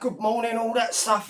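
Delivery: ordinary talk, nothing marked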